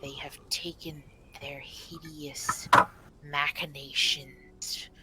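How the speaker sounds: slow, hushed guardian voice, sinking into whisper; soft, halting whisper of an old forest spirit; whispering stag spirit. Acted. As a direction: breathe out each phrase slowly and solemnly. Game master voices the stag spirit.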